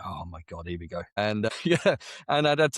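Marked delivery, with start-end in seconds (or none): 0.0-1.0 quiet, low inner groan of resignation; muttered aside to self. Retold or imitated. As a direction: say it under your breath, as a private thought. Quoting his own dread to himself.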